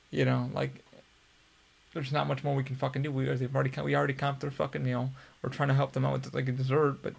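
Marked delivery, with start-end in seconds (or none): none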